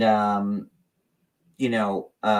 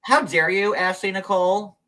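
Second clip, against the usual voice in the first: higher, playfully affronted; mock offence; 6 semitones higher, 3 dB louder than usual. Host to Ashley Nicole teasing the accent, mock offence. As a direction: act wounded by the teasing.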